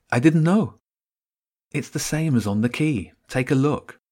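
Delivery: put-on light young girl voice; lightly voiced girl.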